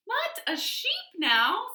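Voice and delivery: high, slow disbelieving question; can't believe the advice; incredulous surprise. Acted. Peter incredulous.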